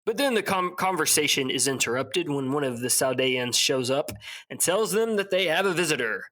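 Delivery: normal talking voice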